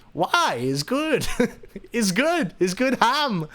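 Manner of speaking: put-on innocent Latvian-accented defence; [name]'s Latvian accent. Latvian guest defending his raw bacon, innocent accented protest. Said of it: "this high tone and in this amazing accent".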